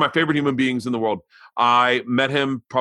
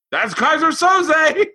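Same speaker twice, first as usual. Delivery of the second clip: much higher, slow triumphant announcement; excited kid; 4 dB louder, slower than usual. Retold or imitated. Daughter proudly naming the killer, high and excited. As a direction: announce it like you cracked the case. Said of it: "And Isla goes".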